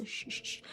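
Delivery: soft unvoiced snaking shh; quiet imitated rustle; whispered shushing sound. A narrator's reading. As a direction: hiss like an arm sliding through leaves.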